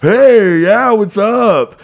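high, loud bro greeting, slow; rowdy greeting. Retold or imitated. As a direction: holler it like drunk buddies. Dudes greeting them loudly.

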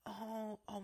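high, soft, helpless little voice; poor little girl. Retold or imitated. Poor little girl caught in a customer's scene, high and soft. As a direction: imitate a flustered young worker. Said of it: "This poor little girl, she's like".

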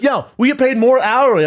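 hammed-up defiant barista; retold shouted retort. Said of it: "She goes".

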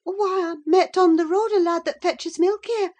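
put-on high, loud, flustered servant girl; flustered maid. A narrator's reading. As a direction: blurt out an explanation in a high girlish voice. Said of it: "she stammered".

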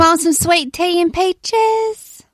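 high, slow Georgia drawl; Georgia accent. Retold or imitated. Mimicking everybody in Georgia, slow heavy Southern accent. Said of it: "the accents in Georgia are heavier".

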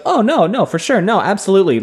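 high, hurried-sounding string of assurances; over-eager reassurance. Acted. Rand over-promises secrecy, acted.

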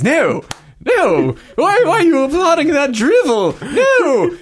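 high, loud furious protest; outraged villain. Acted. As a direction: shriek it in disbelief.

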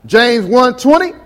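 ordinary delivery

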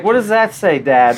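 put-on a kid's curious question, lifted in pitch; imitating his daughter. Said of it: "so she's like".

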